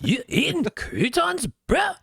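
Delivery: high, slow surfer bro voice; surfer dude. Pretend. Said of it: "talks like a surfer dude".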